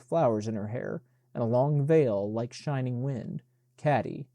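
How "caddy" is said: ordinary delivery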